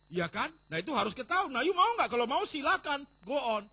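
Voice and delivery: loud sermon challenge; preaching at full force. Acted. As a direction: challenge them loudly.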